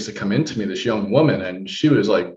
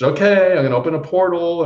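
lightly voiced healer, a bit louder; 6 semitones higher, 4 dB louder than usual. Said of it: "So she's".

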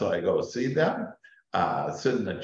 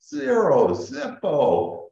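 high, loud triumphant "Zero"; excited reveal; 11 semitones higher, 3 dB louder, slower than usual. Real, not acted. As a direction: pop it up high and loud, thrilled. Punchline of the cost.

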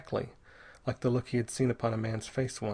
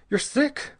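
sharp, high and loud alarm; 6 dB louder than usual. Acted. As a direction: snap out the worry at him. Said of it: "said Bell sharply".

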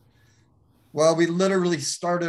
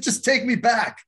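raised, higher plea to be taken back; retold shout; 7 semitones higher, 4 dB louder than usual. Speaker to the pastor, a loud plea to go back. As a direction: call it out, pleading to go back.

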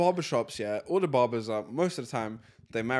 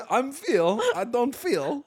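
higher mock imitation of mum's broken English; mimicking mum; 10 semitones higher, 5 dB louder, much slower than usual. Son teasingly imitating his mum's broken English, higher pitch. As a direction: parrot her words teasingly. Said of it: "I am feel".

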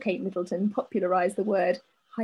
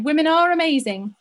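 "Women are amazing" said louder with "are" pushed up; emphatic agreement; 5 semitones higher, 6 dB louder than usual. Acted. Echoing the listener's letter, emphatic and loud. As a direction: agree with it loudly, leaning on "are". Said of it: "which is basically what we just said".

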